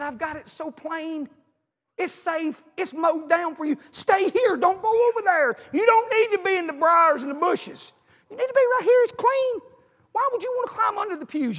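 high, building to loud insistence; urgent preaching plea. Acted. Preacher voicing God calling people to the safe path, urgent.

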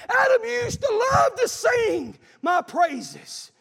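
loud, high and slow shout; slow pleading call of God; God calling Adam. Acted. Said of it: "He's saying".

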